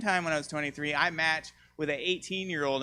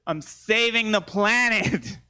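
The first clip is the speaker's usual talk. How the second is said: slow, louder, pompous declaration while staring him down; mock self-righteous; 6 semitones higher, 5 dB louder, much slower than usual. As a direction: preach it slow and proud. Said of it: "I was like".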